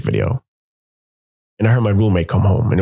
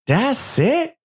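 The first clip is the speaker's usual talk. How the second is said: very high mocking rise; unimpressed scoff. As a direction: scoff at the tiny pour. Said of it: "She's like".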